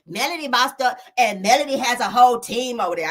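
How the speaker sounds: a hint of Ariana's sassy tone; mock Ariana bragging about Melody's team. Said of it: "I gotta say it in her voice".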